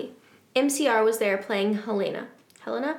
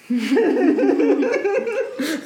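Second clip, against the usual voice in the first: loud, high cackling laugh; uncontrolled giggles; big laughing fit; 9 semitones higher, 6 dB louder than usual. Real, not acted. Cracks up at plot twist.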